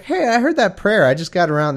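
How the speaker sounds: mock God, high and casual; God as casual guy. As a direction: play God as a laid-back dude catching up on mail. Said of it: "the Lord ... was like".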